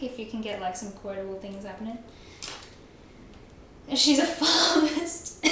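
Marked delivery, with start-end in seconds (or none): 3.9-5.4 slow, high, teasing read of "farmist"; mock accusation. Real, not acted. Streamer reading a chat joke aloud, mock scandalised. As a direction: draw out "She's a farmist" in mock outrage. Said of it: "reads chat quote aloud".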